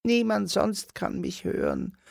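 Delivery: very high, hushed little dwarf voice; whispering dwarf. A narrator's reading. Dwarf secretly tells Simon, very high and hushed. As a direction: confide it like a tiny secret. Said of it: "flüstert der Zwerg".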